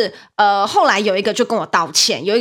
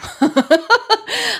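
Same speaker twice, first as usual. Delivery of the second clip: high burst of laughter; bright high laugh; 14 semitones higher, 3 dB louder than usual. Real, not acted. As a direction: laugh out loud at the memory. Laughing at the retold joke.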